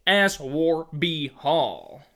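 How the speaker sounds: "Aswarby Hall" said very slowly and clearly; slow careful repeat; slowed repetition. Shown as a demonstration. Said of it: "It was what?".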